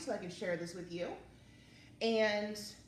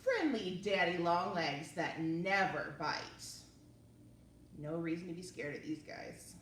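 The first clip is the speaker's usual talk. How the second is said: lively, expressive read-aloud; reading to children. Acted. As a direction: read it to little kids with wonder. Reading picture book aloud.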